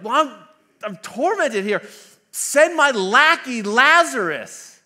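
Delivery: high, very loud mock demand; indignant rich man. Tormented rich man ordering his servant, loud indignant mock wail. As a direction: wail it imperiously, dragging out the order.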